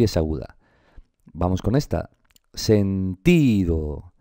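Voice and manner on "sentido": sentido very slow, TI held long; sounded out. Shown as a demonstration. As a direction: stretch the TI of sentido. Teacher sounds out the stress.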